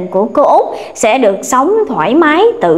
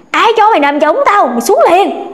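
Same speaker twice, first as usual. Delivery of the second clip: higher, harsh ghost voice ordering her down; ghostly angry order; 7 semitones higher than usual. A narrator's reading. Ghost father-in-law ordering daughter-in-law out of hammock, harsh and angry. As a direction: command her off the hammock at once. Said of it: "Cô nghe kêu".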